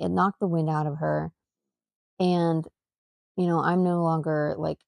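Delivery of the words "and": ordinary talk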